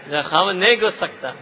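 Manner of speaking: rushed words through a laugh. Real, not acted. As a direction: joke while chuckling, fast. Joking to women, laughing.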